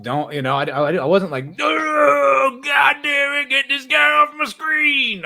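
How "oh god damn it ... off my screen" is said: much higher, louder hammed-up yell; mock outraged shout. Streamer mocking an offended viewer, hammed-up shout. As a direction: ham up an outraged viewer yelling at the TV.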